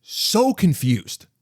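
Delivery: slow, stretched, baffled; drawn-out confusion. Real, not acted. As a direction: drag out the words in disbelief. Recalling his baffled reaction.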